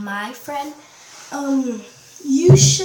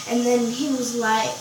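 ordinary talk, nothing marked

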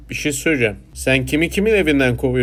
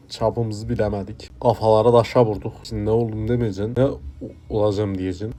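low, soft, resigned has-been; eski şöhret, bezgin; 3 semitones lower, 4 dB quieter than usual. Acted. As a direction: murmur it low, as someone long past their fame. Plays washed-up former TV news figure. (bezgin, alçak sesli eski şöhret)

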